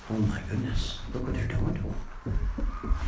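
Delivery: soft, breathless oh my goodness, dropping to a whisper; hushed dismay. Retold or imitated. Preacher voicing Peter watching the beating, hushed dismay. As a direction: Peter horrified under his breath.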